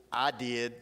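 ordinary talk, nothing marked

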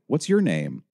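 chatty phone helper speaking up. A narrator's reading. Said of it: "David's voice was instantly louder and yet too conversational".

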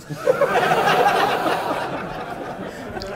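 squealing laugh; real helpless laughter; high shrieking laugh. Real, not acted. Laughing at own story.

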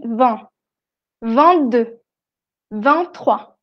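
"vingt, vingt-deux, vingt-trois" with no T closing "vingt"; silent T. Shown as a demonstration. Teacher shows the rule before consonants, silent T in "vingt". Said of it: "la T no suena".

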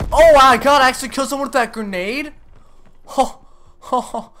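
high, loud, fast disbelief; excited shout. Real, not acted. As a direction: yell it in thrilled disbelief. Surprise kill in game.

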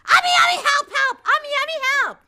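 put-on very high, squealed child's cry; hammed-up, high-pitched panic; yelling for help. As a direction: imitate a small child screaming for mom. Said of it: "Me yelling at the top of my lungs".

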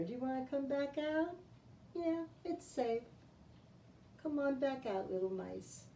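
soft, reassuring call to little ones; gentle coaxing. Pretend. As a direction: coax them out softly. Coaxing hidden mice out.

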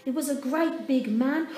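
usual speaking voice

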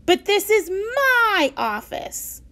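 委屈又生气; high, slow indignant protest; upset. A narrator's reading. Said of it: "The principal was so upset".

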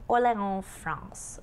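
French accent, "Orléans" as "or-lay-on" with silent s; French pronunciation. Shown as a demonstration. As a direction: say "Orléans" like the French, nasal ending. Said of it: "how they say it in France".

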